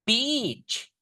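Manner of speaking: beach with a long, stressed ee; stressed vowel. Shown as a demonstration. Teacher explaining beach to Sis Yuli. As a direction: stretch the ee in beach. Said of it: "ada penekanannya".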